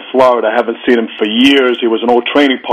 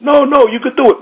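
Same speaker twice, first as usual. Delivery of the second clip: insistent urging; 14 semitones higher than usual. Retold or imitated. Judge insisting.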